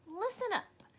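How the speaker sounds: bossy pretend teacher calling the class; playing teacher. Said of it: "Lily pretended to be Mister Slinger".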